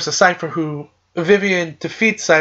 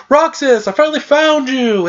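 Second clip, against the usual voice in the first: higher-pitched mock triumphant call; 7 semitones higher than usual. Cloaked man greets Roxas on finding him, calling out. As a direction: play the cloaked man hailing his lost friend.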